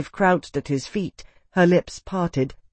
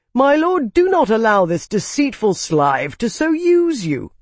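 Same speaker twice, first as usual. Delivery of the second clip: high, loud, frantic appeal; sputtered protest; 8 semitones higher, 4 dB louder than usual. A narrator's reading. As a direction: plead in outraged alarm. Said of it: "sputtered Calyx".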